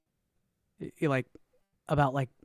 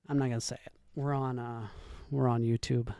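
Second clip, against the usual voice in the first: sheepish retreat; 6 semitones lower, 10 dB quieter, faster than usual. Real, not acted. Backing off after the hype shout.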